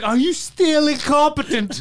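slow, high, loud scornful chef; mock Gordon Ramsay. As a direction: sneer it like a TV chef. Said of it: "and Ramsay's like".

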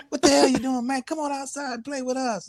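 high, pushed imitation of friends calling; mock yelling up. Friends urging boy to come outside, mock calling up. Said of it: "yell up, like".

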